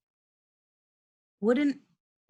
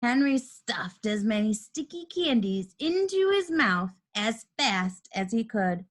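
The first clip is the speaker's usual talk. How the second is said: usual speaking voice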